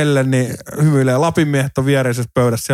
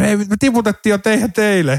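higher-pitched mock yell, no real volume; retold shout; 7 semitones higher than usual. Fan taunting the Swedish supporters, retold shout. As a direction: re-enact heckling the rival fans. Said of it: "Mä huusin niille".

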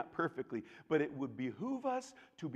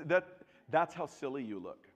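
ordinary talk, nothing marked

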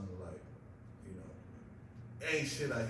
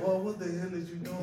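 louder, higher, amused scold; playful scold; 8 semitones higher, 7 dB louder than usual. Retold or imitated. Dad scolding his son for running on all fours. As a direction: scold in fun. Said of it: "And I said".

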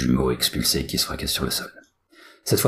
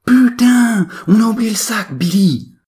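very high, loud yell; high-pitched shouted curse; panicked realisation; 10 dB louder than usual. A narrator's reading. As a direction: cry out to your twin in dismay. Willy realises bag is forgotten.

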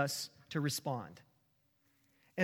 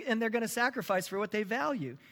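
usual speaking voice

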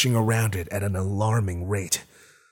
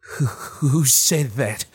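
frightened stammer; 5 semitones higher, slower than usual. Acted. Terrified man stammering.

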